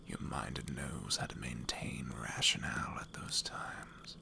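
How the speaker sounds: hushed, slow, deadly serious whisper; a quiet threat breathed close to his ear; a hardened rogue whispers to the boy about real hunger; menacing whispering rogue. Acted.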